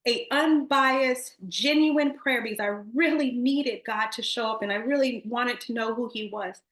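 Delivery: ordinary talk, nothing marked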